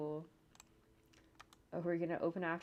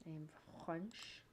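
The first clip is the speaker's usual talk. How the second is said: a weak hint of a French accent, softer; 7 dB quieter than usual. Joking to herself while editing, faint French accent. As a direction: try a French accent and half fail. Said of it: "my attempt at a French accent".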